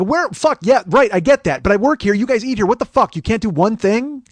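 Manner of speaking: loud, high exasperated rant; acted-out heated argument; retold yelling. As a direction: shout your case in disbelief. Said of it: "I'm kinda yelling".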